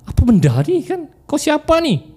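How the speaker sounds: high-pitched retold shock; startled. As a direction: play the startled woman challenging an intruder. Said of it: "terkejutlah dia".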